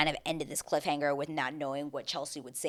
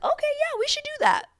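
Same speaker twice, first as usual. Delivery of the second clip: very high falsetto mock agreement; mock falsetto couple; 4 dB louder than usual. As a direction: squeak it like a bored couple. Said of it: "two people just being like".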